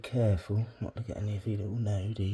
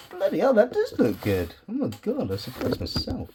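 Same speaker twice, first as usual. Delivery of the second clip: excited; 10 semitones higher, 4 dB louder than usual. Real, not acted. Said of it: "So I got a bit, a bit excited there".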